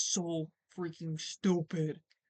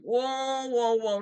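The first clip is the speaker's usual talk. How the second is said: louder, acted-out string of whoas; retold startled reaction; 3 semitones higher, 7 dB louder, much slower than usual. Caller overhearing the screaming roommate, retold startled alarm. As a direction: act taken aback, voice jumping up in volume.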